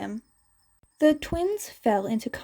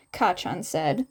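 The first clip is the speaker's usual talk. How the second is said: ordinary delivery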